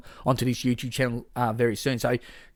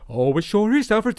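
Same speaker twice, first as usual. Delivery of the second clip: Bruce Wayne voice; 7 semitones higher, 5 dB louder than usual. Retold or imitated. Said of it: "he goes from being like a".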